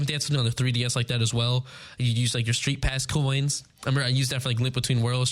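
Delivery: ordinary talk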